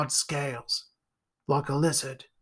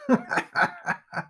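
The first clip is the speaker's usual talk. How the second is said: creepy high cackle. Acted. Creature laughs at frightened Jack.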